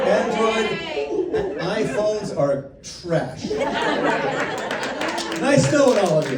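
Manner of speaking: mock disdain, laughing. Real, not acted. To a fan asking about Android, laughing mock disdain. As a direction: tease the iPhone fans through a laugh. Playful jab at iPhones.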